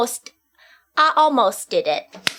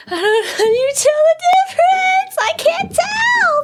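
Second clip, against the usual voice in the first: high wailing whine; mock crying; 5 dB louder than usual. Real, not acted. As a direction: fake sob of despair. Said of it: "I am screwed".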